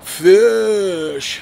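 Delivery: loud, drawn-out sneer on "Fish."; snarky mock. Mocking the host's snarky name for fans, sneering. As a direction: stretch "Fish." with contempt. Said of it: "he says it in this fucking snarky voice".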